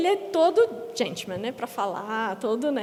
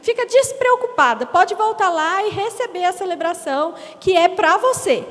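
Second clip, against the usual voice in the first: high, loud, gushing reassurance; sugary churchwoman; 5 semitones higher, 5 dB louder than usual. Retold or imitated. Churchwoman reassuring the mother about her son, gushing. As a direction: gush over the baby to calm mum.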